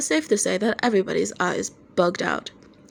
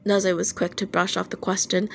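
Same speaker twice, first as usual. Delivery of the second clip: usual speaking voice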